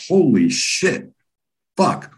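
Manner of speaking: slow, high-pitched disbelief; awestruck. Retold or imitated. Onlooker seeing the frightened man cured, awestruck. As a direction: stretch it out in astonishment. Amazed as the man says hello.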